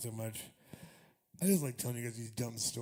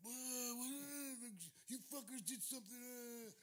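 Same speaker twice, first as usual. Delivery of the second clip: much higher and softer, imitating alarmed confusion; a rising, breathless what-is-happening; half-panicked accusation at bandmates; mock alarm; 9 semitones higher, 12 dB quieter than usual. Retold confusion stuck in bunk.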